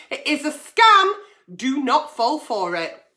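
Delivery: usual speaking voice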